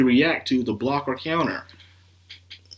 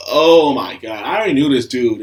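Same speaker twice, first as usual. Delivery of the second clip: excited outburst; 3 semitones higher, 7 dB louder than usual. Real, not acted. Excited realization about villain.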